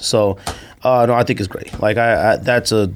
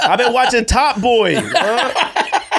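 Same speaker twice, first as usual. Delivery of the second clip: put-on Jamaican accent; 9 semitones higher, slower than usual. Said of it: "Why you doing".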